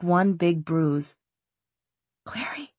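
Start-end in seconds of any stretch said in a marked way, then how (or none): 2.2-2.7 hushed, whispered call; very soft whisper. A narrator's reading. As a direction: whisper the name as a question in the dark. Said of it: "a whispered voice".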